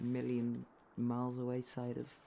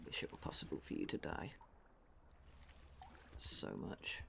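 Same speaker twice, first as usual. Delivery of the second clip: muttering; 9 semitones lower, 8 dB quieter than usual. Real, not acted. Muttering at enemy.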